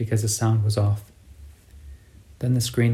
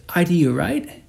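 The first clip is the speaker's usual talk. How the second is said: put-on sing-song northern accent; 7 semitones higher, 3 dB louder than usual. A narrator's reading. Cabbie explaining the retraining, northern Argentine lilt. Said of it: "in the sing-song accent of the northern provinces".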